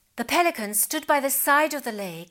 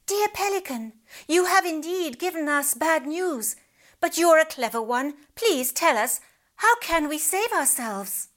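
higher, hopeful pleading voice; pleading fat fish; 6 semitones higher than usual. A narrator's reading. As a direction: beg the clever bird politely.

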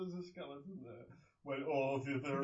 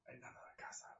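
breathy unvoiced whisper; 4 semitones lower, 16 dB quieter than usual. Real, not acted. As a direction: whisper the punchline. Quiet punchline aside.

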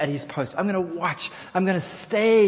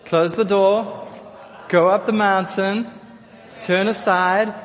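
call-out phrase; 2 semitones higher, 5 dB louder, slower than usual. Shown as a demonstration. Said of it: "Say it all together".

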